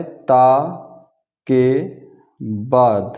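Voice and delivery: drawn out, one word at a time; slow dictation. Real, not acted. Teacher dictating the next note to students, slow and spaced. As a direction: leave writing pauses after each word. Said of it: "इसको यहाँ भी लिख लो".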